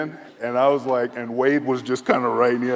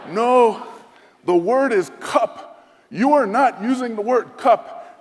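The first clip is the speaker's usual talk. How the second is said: much higher and louder, slow emphatic; insistent general; 11 semitones higher, 8 dB louder, slower than usual. Retold or imitated. General insisting on the missing word, high and loud. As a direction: hammer the point home as the general.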